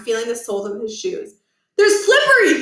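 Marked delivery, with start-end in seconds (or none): 1.8-2.6 high, loud alarmed yell; startled teen shout. Acted. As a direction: yell it from the floor. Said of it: "he shouted".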